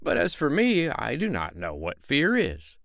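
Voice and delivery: the tinker showing off his courage; boastful tinker. Acted. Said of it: "said the tinker".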